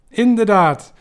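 a high, loud shout with a mouth full of fries. Acted. Sikko Mansholt shouts back at Jaap in the snack bar, loud and high. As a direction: yell it out, bursting with agreement. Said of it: "schreeuwde Sikko Mansholt".

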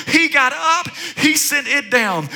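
ordinary talk, nothing marked

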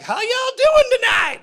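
high hyped concert shout; screaming stadium frontman; rock-star angel. Pretend. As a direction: greet the crowd like a rock star. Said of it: "one angel's gonna look down and be like".

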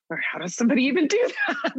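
high, quick, laughing disbelief. Retold or imitated. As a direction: toss it off with a laugh, amazed. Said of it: "And I was like".

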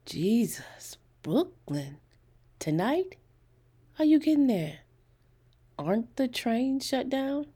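high, incredulous questions; wide-eyed surprise. A narrator's reading. College boy hears she must get to Brooklyn tonight, astonished. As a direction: react in disbelief, pitch climbing with each question. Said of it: "His eyes widened".

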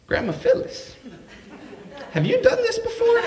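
high, disbelieving squeak of a question; incredulous grandson catching grandma out; shocked question. Retold or imitated. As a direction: ask it stunned, voice jumping up high.

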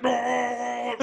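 high strained yell; hammed-up villain scream; mock anguished cry. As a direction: wail it like a cornered villain. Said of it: "He's just in there like".

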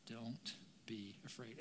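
very soft, slow, low "don't be afraid"; gentle reassurance. Acted. Jesus still reassures the listeners, softly and slowly. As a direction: let each word settle quietly.

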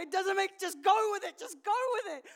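loud, high, blurted-out inner voice; frantic self-urging. Retold or imitated. Woman arguing herself into walking forward, frantic shout. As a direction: shout it as exasperated self-talk.

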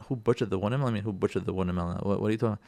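low, soft, bewildered echo; puzzled husband. Acted. Husband echoing his wife's accusation, quietly baffled. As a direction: repeat it under your voice, baffled. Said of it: "And you're like".

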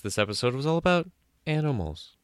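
usual speaking voice

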